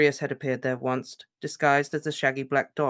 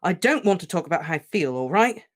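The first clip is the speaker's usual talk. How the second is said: louder, furious refusal; raging, louder outburst; shaking with rage; 5 semitones higher, 5 dB louder than usual. Acted. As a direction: snap back in rage. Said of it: "shaking with rage".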